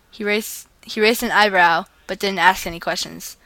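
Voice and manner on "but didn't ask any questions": usual speaking voice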